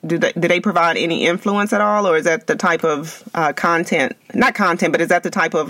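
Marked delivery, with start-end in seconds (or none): none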